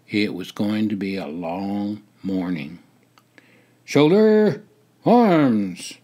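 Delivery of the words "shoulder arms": high, loud parade-ground order, words split apart; drill command. A narrator's reading. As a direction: call it out to the platoon. Sergeant's drill command in narration.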